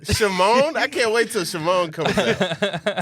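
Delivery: loud and high through laughter; laughing excitement. Real, not acted. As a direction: giggle out the title loudly. Laughing excited about Shimon.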